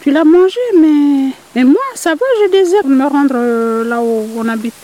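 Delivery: voix de poule haute et forte qui reproche; la poule lâche le rat, plus haute et plus forte; poule sultane fâchée. Acted. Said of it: "la personne a dit". (la poule abandonne le rat, voix haute et forte)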